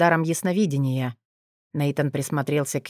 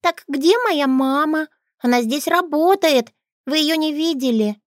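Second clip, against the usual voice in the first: put-on little girl voice; 12 semitones higher, 4 dB louder than usual. Little girl asks for mother.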